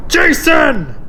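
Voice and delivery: loud, high desperate call; echoing shout. Acted. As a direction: yell his name into the dark. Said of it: "Batman's voice echoing".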